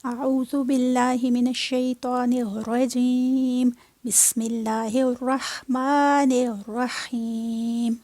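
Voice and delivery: slow, measured Quranic recitation. Real, not acted. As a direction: draw out each word devoutly. Teacher recites opening formula.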